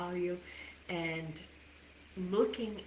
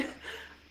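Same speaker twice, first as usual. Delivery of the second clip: soft laugh; 5 dB quieter than usual. Real, not acted. Laughs at own story.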